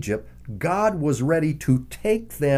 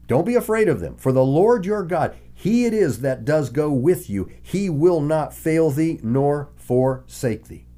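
louder, higher proclamation of Moses' words; preaching emphasis; 4 semitones higher, 3 dB louder than usual. Acted. Quoting Moses with force.

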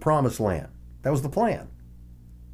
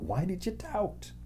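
gentle questioning; 8 semitones higher, 10 dB quieter than usual. Retold or imitated. Paraphrasing Jesus' rebuke.

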